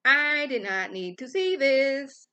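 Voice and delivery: high, drawn-out protest; exasperated. Retold or imitated. Exasperated reaction to gross sores.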